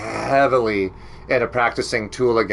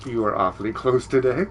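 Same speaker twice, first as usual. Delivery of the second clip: usual speaking voice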